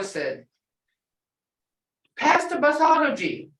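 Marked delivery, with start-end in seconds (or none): 2.2-3.5 higher, louder call of "Pass the pathology"; a little boy insisting on his snack; child's demand. Retold or imitated. Grandson calling to his sisters, higher and louder.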